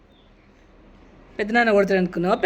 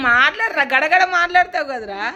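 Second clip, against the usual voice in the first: loud urging; 4 semitones higher, 5 dB louder than usual. Real, not acted. Urging silent boy to talk.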